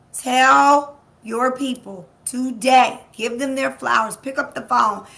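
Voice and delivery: rallying call. Real, not acted. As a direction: rouse them, stretch each word. Rallying the audience.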